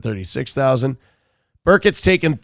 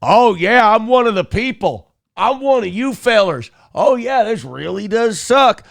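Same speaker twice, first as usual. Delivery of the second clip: mock folksy politician; 6 semitones higher than usual. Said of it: "tired of the lip service of just".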